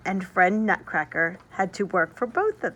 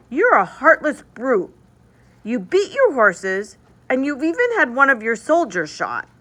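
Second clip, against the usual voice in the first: put-on high, louder upset girl; tearful scolding girl; 5 semitones higher, 4 dB louder than usual. Marie defends Nutcracker from her brother, high tearful scolding. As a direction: scold him through tears.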